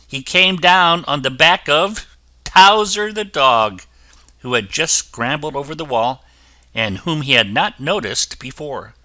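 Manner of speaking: ordinary delivery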